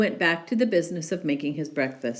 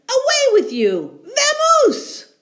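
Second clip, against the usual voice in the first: much higher, louder furious bear; furious shout; 9 dB louder than usual. Acted. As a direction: chase the mouse out. Bear chasing mouse.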